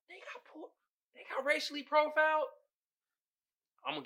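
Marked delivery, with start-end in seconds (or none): 0.1-2.5 shocked disbelief. Retold or imitated. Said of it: "I was like".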